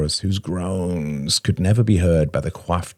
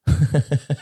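high, scornful laugh; 10 semitones higher than usual. A narrator's reading. Helen laughs at her friend's comparison, mocking laugh. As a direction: laugh lightly at the remark. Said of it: "Helen laughed".

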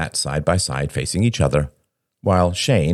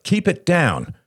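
high-pitched scolding cry; urgent hushing reprimand; cried out; 13 semitones higher, slower than usual. A narrator's reading. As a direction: rein him in urgently, voice pitched up. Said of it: "cried Robert".

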